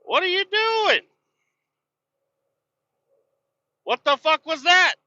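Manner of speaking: real high-pitched outburst at another driver; incredulous, voice shooting way up; road rage. Real, not acted. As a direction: shriek in disbelief at a driver's move. Reacting to another driver while driving.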